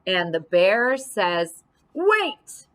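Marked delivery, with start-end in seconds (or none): 1.9-2.7 high, sudden call to stop; urgent call. Acted. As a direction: cry out to stop them. Bear calls out.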